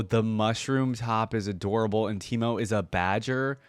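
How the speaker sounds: ordinary talk, nothing marked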